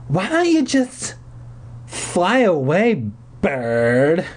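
high, drawn-out sneer; nasty growl. A narrator's reading. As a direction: stretch it into a nasty taunt. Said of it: "she growled nastily".

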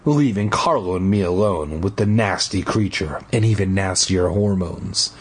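ordinary talk, nothing marked

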